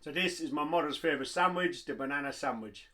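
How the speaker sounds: faint far-off voice, off mic. Real, not acted. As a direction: step back from the mic and say it from across the room. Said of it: "get off the mic and let this guy take over".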